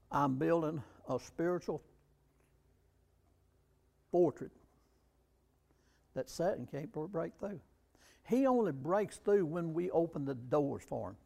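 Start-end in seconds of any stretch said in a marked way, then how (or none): none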